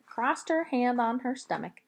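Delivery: ordinary delivery, nothing marked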